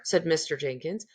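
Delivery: plain reading voice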